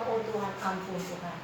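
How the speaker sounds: low, hushed imitation of a prayer; quiet plea. Preacher retelling their own prayer for forgiveness, hushed plea.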